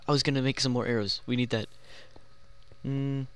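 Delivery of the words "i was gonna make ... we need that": whispered. Real, not acted. Muttering alone.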